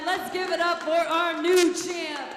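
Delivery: big booming announcer voice, stretching the name; ring announcer call. Acted. Ring announcer to the arena crowd, booming title call. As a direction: crown the new champ to the crowd.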